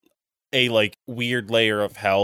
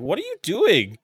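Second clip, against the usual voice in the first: incredulous; 10 semitones higher, 4 dB louder than usual. Retold or imitated. Mocking the bad movie scene.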